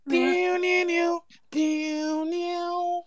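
soft, high, held pew sounds; sung pew noises. Pretend. Following the [name] line, singing pew noises. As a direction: sing out long, high, quiet pews.

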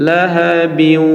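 "লাহাবিউ" slowly chanted, held on the final glide; "লাহাবিউ" with drawn-out "-iu"; no pause ending. Shown as a demonstration. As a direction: carry "লাহাবিউ" on into the waw. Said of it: "ওয়াকফ যদি না করি".

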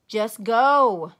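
loud, short grouchy order; grouched. Acted. The impatient game lady orders Junie B. loudly and grouchily. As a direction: snap at the dawdling kid. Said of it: "she grouched".

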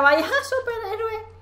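high, slow laugh through the words; mock the cowardly hero laughing. Real, not acted. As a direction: some superhero, said giggling.

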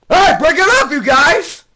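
loud, high order to stop; hammed-up referee's holler; mock scolding shout. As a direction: yell to break up a fight. Breaking up fighting plush toys.

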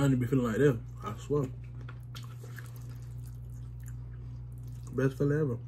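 talking while chewing food; words around a mouthful. Real, not acted. Seen 1: Looking down, lips pressed and working between words; eating with fork after.

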